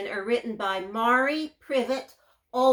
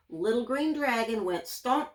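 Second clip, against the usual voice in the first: ordinary delivery, nothing marked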